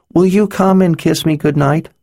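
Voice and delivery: put-on high, hopeful child request; sweet little girl. Girl asking the wizard for a goodnight kiss, high and hopeful. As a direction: ask it like a small girl at bedtime.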